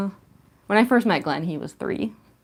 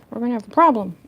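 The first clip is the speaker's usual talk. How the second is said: louder mock stern warning; loud mock threat; 4 semitones higher, 8 dB louder, faster than usual. Real, not acted. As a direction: push it out as a playful threat. Repeated louder.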